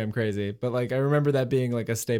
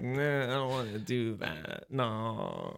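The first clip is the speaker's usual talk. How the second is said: soft, creaky fry, trailing off; lazy vocal fry, much softer; vocal fry refusal; 8 dB quieter, slower than usual. Shown as a demonstration. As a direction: groan it out in fry like you can't be bothered. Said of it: "That's when vocal fry is okay".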